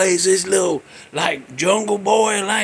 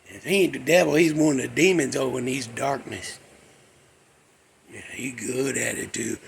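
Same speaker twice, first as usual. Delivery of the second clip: ordinary talk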